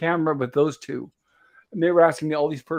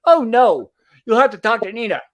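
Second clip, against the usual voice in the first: retold loud dismissal; 4 semitones higher, 7 dB louder than usual. Quoting Bill dismissing her.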